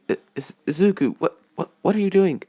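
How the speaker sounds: high, stumbling, flustered; flustered stammer. Acted. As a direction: caught off guard and stammering. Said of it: "blushing and getting a nosebleed saying".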